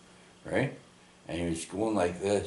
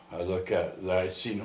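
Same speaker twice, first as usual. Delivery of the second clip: normal talking voice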